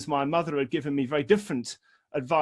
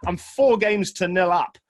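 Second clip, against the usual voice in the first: insistent protest; 3 semitones higher, 6 dB louder than usual. Retold or imitated. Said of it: "He said".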